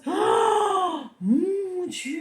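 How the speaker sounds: high gasp of alarm; fairy spots the dragon in the sky, aghast; shocked fairy. Acted. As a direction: cry out as if disaster looms. Fairy sees dragon overhead.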